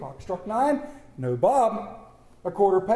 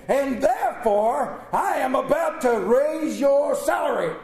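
high, loud, slow, savoring the surprise; gleeful reveal; 6 semitones higher, 4 dB louder, slower than usual. Acted. Scrooge surprising Bob with a raise, high and slow. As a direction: draw it out then spring the news.

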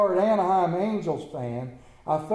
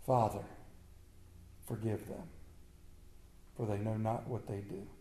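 quiet; 8 semitones lower, 14 dB quieter than usual. Acted. Jesus' words quoted softly.

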